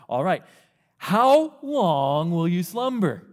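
slow drawn-out mocking taunt; sarcastic. Solomon taunting the lazy person, slow and sarcastic. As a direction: drag it out to needle him. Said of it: "a hint or a sense of sarcasm".

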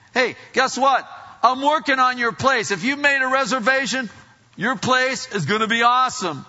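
loud, high-pitched good news; excited announcement. Acted. Voices Jesus announcing the prepared place.

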